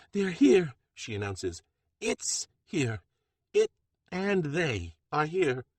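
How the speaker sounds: high and loud, then slowing ominously; breathless dread at the news; frantic announcement. Acted. Jet warning the kitchen they've arrived, frantic then ominous.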